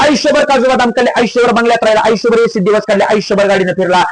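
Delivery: high, ringing sermon storytelling; impassioned preaching. Real, not acted. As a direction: launch high and drive the parable on, easing down at phrase ends. (आवेशपूर्ण kirtan)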